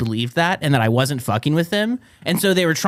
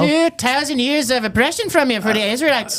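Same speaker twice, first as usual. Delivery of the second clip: mock Irish accent; 8 semitones higher than usual. Joining the Irish bit.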